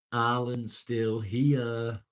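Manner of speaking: playful drawn-out greeting; drawn-out call. Real, not acted. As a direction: stretch it out like a cheery announcement. Drawn-out sing-song greeting.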